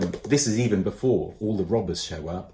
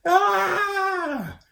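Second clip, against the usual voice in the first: sudden high yelp of fright; high startled cry; 6 dB louder than usual. A narrator's reading. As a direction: shriek as you shoot upward. Ben shoots up into trees.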